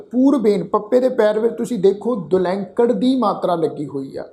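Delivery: normal talking voice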